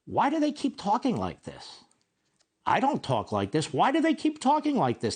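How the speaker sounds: high-pitched, indignant rhetorical question; exasperated. Retold or imitated. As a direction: plead in exasperation, pitch jumping up. Quoting own exasperated radio rant.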